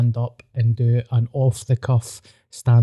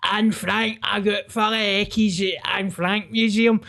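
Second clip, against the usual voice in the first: mock unhinged ranter; 8 semitones higher than usual. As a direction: squeak it out like a deranged bus passenger. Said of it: "a mentally ill person ... just being like".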